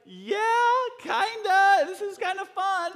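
put-on high, loud kid squeal; nervously thrilled daughter. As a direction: squeal it like a scared but excited child. Daughter voiced after the scary fall.

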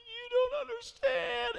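high, slow, tearful wail; imitated crying. Administrator breaking down over the note, tearful wail. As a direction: mimic her breaking into tears. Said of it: "starts crying".

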